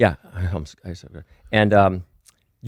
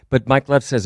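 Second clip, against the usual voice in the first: normal talking voice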